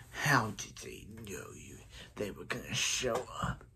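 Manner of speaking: low, hushed villain voice; deep soft sinister figure; Zoom voice. Acted. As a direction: question them in a soft, low menace. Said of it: "I do a very good Zoom voice".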